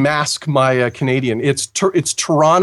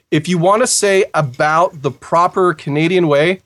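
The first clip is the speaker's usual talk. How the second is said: ordinary talk, nothing marked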